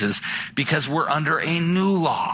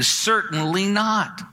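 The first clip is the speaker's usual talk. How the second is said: CERTAINLY NOT drawn out slowly and pitched higher; slow, higher, emphatic weight on "Certainly not"; 5 semitones higher, slower than usual. Retold or imitated. Preacher quoting Paul's answer to the question, emphatic. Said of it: "it's emphatic".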